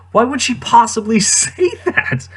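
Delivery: laughing disbelief. Real, not acted. Laughing disbelief at Padme's line.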